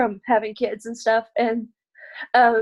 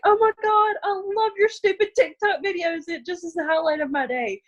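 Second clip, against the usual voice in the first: much higher, squealing fan voice; gushing fan; 8 semitones higher than usual. Retold or imitated. Commenters praising her videos, squealing high. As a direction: gush like an excited fan. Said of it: "they're like".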